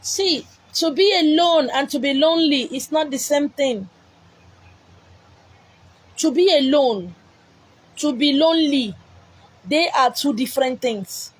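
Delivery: loud, deliberate preaching; measured sermon emphasis; emphatic preaching. Acted. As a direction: drive home the alone-lonely point. Preaching lines on alone and lonely.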